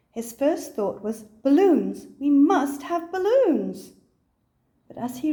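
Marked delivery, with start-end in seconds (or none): 1.2-3.9 loud, excited cry, slowing on the second line; bursting with a sudden idea; shouting out the plan. Acted. Excited rush downstairs.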